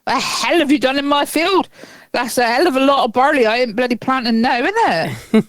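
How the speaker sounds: put-on aggrieved Dorset farmer accent, swooping in pitch; local Dorset accent. Farmer finding stones in his field, in Dorset accent. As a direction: play an indignant farmer with a broad Dorset accent. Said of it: "they probably sound more like they would down here".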